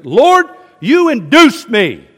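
loud, high, slow cry to God; impassioned complaint. Acted. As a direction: cry it out in protest. Reads Jeremiah's complaint to God.

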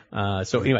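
ordinary delivery

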